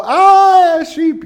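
high shrieking fake fright at a sheep; mock terror. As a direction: scream like a sheep is a monster. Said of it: "you don't go".

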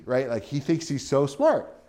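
ordinary talk, nothing marked

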